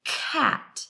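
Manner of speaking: cat whispered sound by sound; cat said slow and breathy; stretched sounds. Shown as a demonstration. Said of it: "think of first about the beginning sound in".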